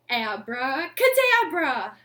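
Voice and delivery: loud showy magician's call, falling at the end; magic word. Pretend. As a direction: proclaim it like a stage magician. Magic trick for kids again.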